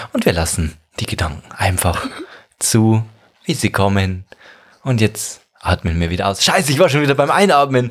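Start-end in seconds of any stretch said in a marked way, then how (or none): 0.1-6.4 guided meditation voice. Retold or imitated. Parodies guided meditation app.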